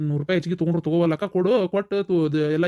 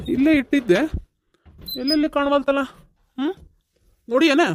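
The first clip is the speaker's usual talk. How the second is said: raised, higher-pitched hollering; calling out loudly; 6 semitones higher than usual. Acted. Husband calls Shanta about a lost bank receipt, hollering. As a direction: holler to the house while hunting for something. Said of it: "ಏನ್ ಒದರ್ತೀರ್ರಿ?".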